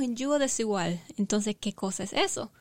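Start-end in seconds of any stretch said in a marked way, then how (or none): none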